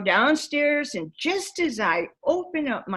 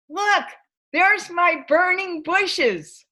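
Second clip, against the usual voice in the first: triumphant and awed; 9 semitones higher, 4 dB louder, slower than usual. Retold or imitated. Pointing out the light on the lake.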